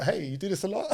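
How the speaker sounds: high, squeaky mock-nervous question; nervous small talk. Strapped to the instructor, asking him in a high nervous voice. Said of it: "it's like".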